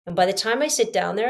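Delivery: usual speaking voice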